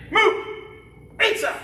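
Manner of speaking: high, loud command call; drill command shout. Real, not acted. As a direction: snap the command out to the class. Instructor calling drill commands.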